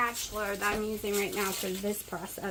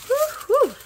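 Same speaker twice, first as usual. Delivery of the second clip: high, loud hissing whew; pained blowing whew; 10 dB louder than usual. Real, not acted. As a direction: blow out sharply at the burn. Burning fingers on hot food.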